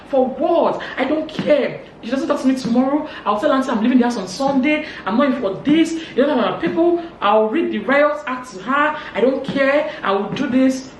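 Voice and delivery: high, loud, hammed-up indignation; retold angry rant. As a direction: act out someone working up a defiant rant. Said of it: "Obi started getting angry".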